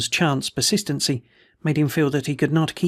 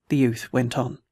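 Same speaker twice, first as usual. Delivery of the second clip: usual speaking voice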